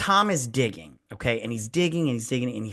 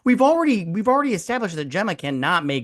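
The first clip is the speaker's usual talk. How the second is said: high-pitched incredulous rant; hammed-up telling-off, voice soaring high; exasperated scolding; 9 semitones higher than usual. Telling off Tom for letting Gemma handle this, exasperated.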